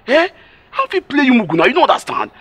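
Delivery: indignant protest. Acted. Agent protests loudly to the doubting customer. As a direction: agent loudly denies cheating her.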